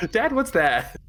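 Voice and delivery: put-on high, small kid asking dad; child voice. Player voicing a child asking dad what that is, high kid voice. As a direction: squeak it out like a little kid.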